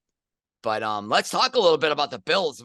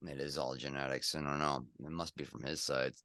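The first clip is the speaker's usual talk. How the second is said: much lower, much softer mumble; muttered aside; 12 semitones lower, 13 dB quieter than usual. Real, not acted. Joking to co-host about genetics, low muttered aside. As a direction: mutter it under your breath.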